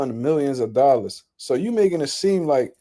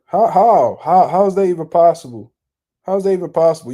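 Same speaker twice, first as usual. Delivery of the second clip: loud, disbelieving repeated how; can't believe what he's reading; incredulous; 3 semitones higher, 6 dB louder than usual. Real, not acted. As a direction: vlogger baffled by the quote, louder and higher. Disbelief at a fighter's quote.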